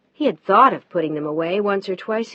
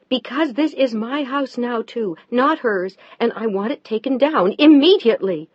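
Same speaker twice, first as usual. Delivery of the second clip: higher, louder near-shout; raised angry near-shout; almost shouting; 4 semitones higher, 6 dB louder than usual. Acted. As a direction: nearly shout it at him. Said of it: "She was almost shouting at him".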